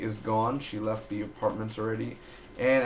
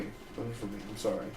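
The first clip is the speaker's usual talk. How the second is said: "It wasn't for me." repeated much softer, almost to himself; quiet repeat; 8 dB quieter than usual. Real, not acted. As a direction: drop the volume and say "It wasn't for me." under your breath. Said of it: "It's just, it wasn't for me".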